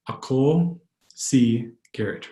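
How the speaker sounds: drawn-out words with gaps; slow, word by word. Shown as a demonstration. Teacher repeating an introduction for learners, slowly word by word. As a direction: separate "Ako", "si" and "Garrett" clearly.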